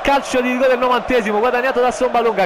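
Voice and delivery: high, loud penalty shout; breathless italian goal commentary. Acted. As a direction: shout the penalty call in disbelief.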